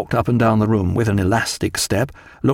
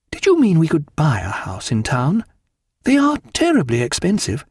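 higher-pitched wife's voice; Diana; 6 semitones higher than usual. A narrator's reading. Wife discussing stables and a town house, high and lively. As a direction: lift the pitch for a lively lady.

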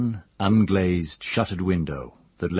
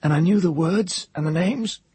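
soft hoarse voice; 12 semitones higher, 4 dB louder than usual. A narrator's reading. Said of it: "in a soft, hoarse voice".

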